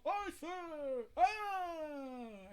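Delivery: a high loud cry of Arthur then a scream; yell. A narrator's reading. Volstagg yells to Arthur as he is cut down, loud and high. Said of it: "for Volstagg to yell".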